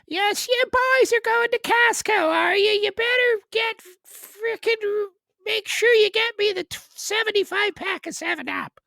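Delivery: high, loud Newfoundland voice; Newfoundland accent. Pretend. Newfoundland local sends the boys to Costco, high and loud. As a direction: boss the boys around in a thick accent.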